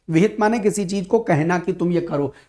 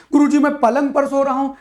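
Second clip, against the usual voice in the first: mock student objection; 5 semitones higher, 3 dB louder than usual.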